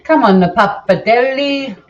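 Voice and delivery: put-on stupid Italian accent. Australian home cook hamming up pappardelle on the live stream, mock Italian accent. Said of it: "doing a stupid Italian accent".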